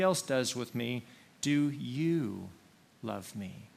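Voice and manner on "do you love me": single out YOU, pause, then drop away; stress on YOU. Shown as a demonstration. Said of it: "emphasize different words".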